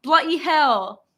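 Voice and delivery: emphatic mock British; attempted British accent. Shown as a demonstration. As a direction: show off a British imitation. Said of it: "I can do that one better".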